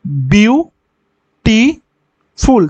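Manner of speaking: বিউটিফুল very slowly as বিউ, টি, ফুল with long gaps; syllable by syllable. Shown as a demonstration. Teacher sounding out বিউটিফুল to count syllables, syllable by syllable. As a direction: break বিউটিফুল into three separate beats, pausing after each. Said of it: "উচ্চারণ করো বিউটিফুলটাকে".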